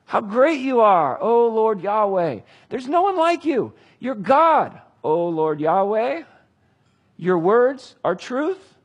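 David pouring out praise to God, slow and ringing; rising praise. Retold or imitated. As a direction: lift it up to God in awe, drawn out. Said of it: "he prays one of the great prayers".

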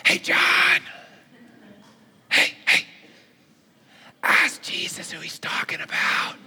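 hushed whisper to a friend at the table. Retold or imitated. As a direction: whisper it so nobody else hears. Peter laying low at dinner.

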